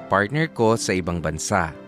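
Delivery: ordinary delivery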